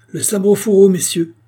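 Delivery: high, loud urgent order; shouted command. A narrator's reading. King to his grenadiers, shouting high. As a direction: shout to halt armed men. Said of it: "cria le roi".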